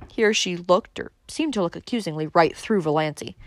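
ordinary delivery

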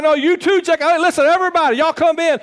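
high priest summoning all the priests loudly; shouting priests in. Acted. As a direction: call everybody in at the top of his voice. Azariah calling all the priests.

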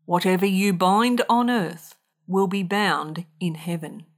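ordinary talk

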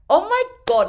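high, loud disbelieving oh my God; shocked exclamation. Real, not acted. Recalling the pizza guy's comment, loud and disbelieving. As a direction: gasp it out in disbelief.

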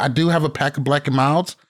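ordinary talk

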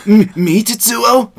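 high, loud anime voice; overacted anime dub. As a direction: shout it like an anime hero. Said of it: "You sounded like an anime character".

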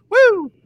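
short, high-pitched woo; high whoop. Real, not acted. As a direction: let out a quick excited whoop.

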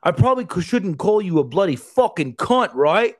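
high, loud mock Aussie bloke; Aussie guy. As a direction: put on a cheeky Australian accent and ask it straight. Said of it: "and he goes".

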